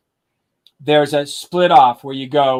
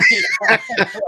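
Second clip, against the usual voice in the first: a much higher, shouted cackle; gloating laugh. Retold or imitated. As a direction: burst out in a triumphant shouted laugh. Freddie gloats after road closure.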